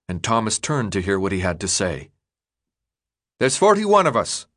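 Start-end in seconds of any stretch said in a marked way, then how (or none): 3.4-4.5 higher, raised leader's voice; addressing a crowd. A narrator's reading. Newt calls the gathered Gladers to order, raised address. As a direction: call it out to the gathered group.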